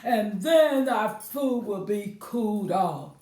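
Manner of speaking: a touch of a big papa bear. Acted. Papa Bear suggests a walk.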